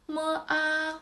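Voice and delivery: "mo, a" high and level; first tone. Shown as a demonstration. Teacher spells out the syllable, "mo, a" high and flat. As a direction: hold "mo, a" flat on a high pitch. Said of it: "The first tone is flat".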